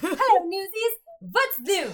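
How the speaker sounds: high, bright-toned showgirl hello; diva stage greeting. Retold or imitated. Imitating Medda greeting the newsies, high and playful. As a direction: sing out a grand welcome.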